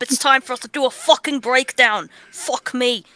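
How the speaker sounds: ordinary delivery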